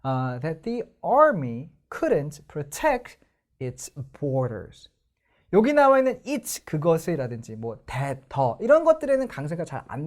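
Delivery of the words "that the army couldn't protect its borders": very slow, army and tect stressed; stressed reading. Shown as a demonstration. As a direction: lay the stress on each key word slowly. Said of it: "강세가 뒤에 있죠".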